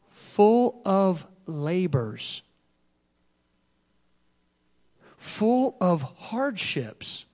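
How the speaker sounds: very slow, drawn out; slow emphasis. Real, not acted. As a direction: let each word sink in slowly.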